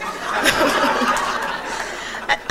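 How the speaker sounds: soft unvoiced laugh at the memory; breathy laugh. Real, not acted. A woman laughing while telling her aunt story. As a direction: laugh it out under your breath.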